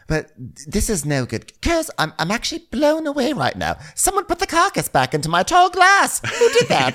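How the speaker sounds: high mock outrage, rising; hammed-up indignant diva; imitating Dorit. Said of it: "and she's like".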